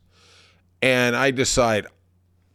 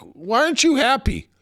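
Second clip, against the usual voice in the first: high-pitched mock question from a puzzled buddy; squeaky-high retold disbelief; incredulous friend; 13 semitones higher than usual. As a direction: imitate a baffled friend, voice pitched way up.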